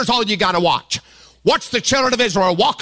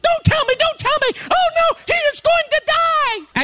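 very high, frantic mock panic; panicked wife. Pastor imitating his wife panicking during a movie, high and frantic. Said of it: "She is at total panic".

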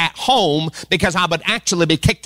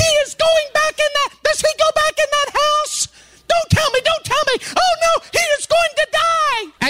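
much higher, frantic movie panic; panicked wife. Retold or imitated. Wife panicking through a suspense movie, frantic high cries. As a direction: squeal like you fear for the hero. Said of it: "while Angie's going off".

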